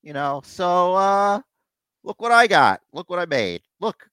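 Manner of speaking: a hint of a proud boss showing off; imitating Triple H. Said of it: "It's like".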